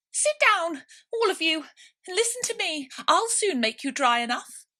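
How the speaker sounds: very high, loud squeaky mouse call; shrill little mouse demanding order. A narrator's reading. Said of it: "called out".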